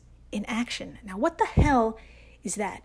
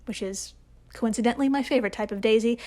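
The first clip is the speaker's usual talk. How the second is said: quick aside; faster than usual. Real, not acted. Throwaway joke aside.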